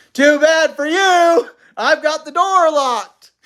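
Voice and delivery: loud, slow, high-pitched taunt; rudely calling out. A narrator's reading. Father taunting mother from behind the locked door, calling out rudely. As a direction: call it out, drawn out and gloating. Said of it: "laughed rudely and called out".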